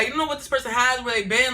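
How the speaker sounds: ordinary talk, nothing marked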